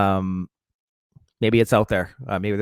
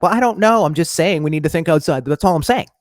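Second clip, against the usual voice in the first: mock defensive shrug; 6 semitones higher, 5 dB louder than usual. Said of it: "And I'm like".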